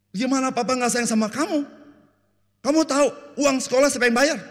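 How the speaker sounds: high, loud indignant dad; defensive father. Acted. Preacher plays a busy dad scolding his child, loud and high. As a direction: bristle as if the kid is ungrateful. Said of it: "Papa bilang gini".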